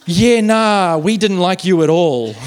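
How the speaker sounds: loud, drawn-out imitated manager; hammed-up dismissal, slow and emphatic; mock manager letting someone down, stretched out; blunt rejection.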